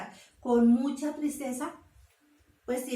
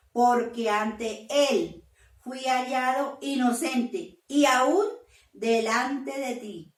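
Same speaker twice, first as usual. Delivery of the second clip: proclaiming; 3 semitones higher, 6 dB louder, slower than usual. Acted. Daniel answers the king from the den, slow and loud. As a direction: proclaim innocence boldly to the king.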